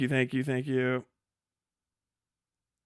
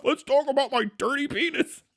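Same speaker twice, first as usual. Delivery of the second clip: mock quote; 8 semitones higher than usual. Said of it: "But he starts off".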